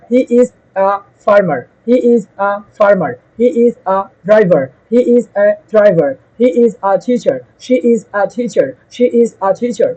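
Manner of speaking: slow, clear model sentences one by one; slow drill sentences. Shown as a demonstration. Teacher to students, slowly modelling sentences to copy. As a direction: read them slowly for students to repeat. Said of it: "এখান থেকে নাম বলবেন".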